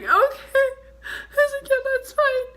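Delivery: high, teary voice; crying. Retold or imitated. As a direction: sob out a brave okay. Crying reply to officer.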